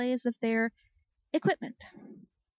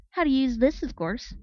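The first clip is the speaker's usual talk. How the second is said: matter-of-fact; 4 semitones higher, 4 dB louder than usual. A narrator's reading. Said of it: "replied matter-of-factly".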